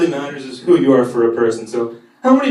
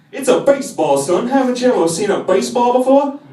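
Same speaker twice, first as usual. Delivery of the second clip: Foghorn voice; 8 semitones higher than usual. Retold or imitated. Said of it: "he says".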